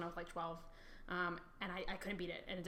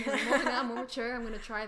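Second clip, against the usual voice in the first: laughing mock boast; 3 semitones higher, 7 dB louder than usual. Friend laughs retelling her childhood boast, laughing. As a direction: repeat the boast while laughing at yourself.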